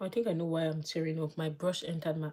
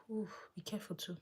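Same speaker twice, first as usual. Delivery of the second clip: soft breathy whisper to herself; careful whisper; 3 semitones higher, 8 dB quieter than usual. Real, not acted. Vlogger whispering to herself while doing makeup near her eye, hushed warning.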